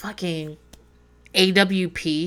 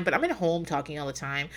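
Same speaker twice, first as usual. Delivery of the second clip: ordinary talk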